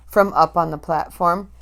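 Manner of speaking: usual speaking voice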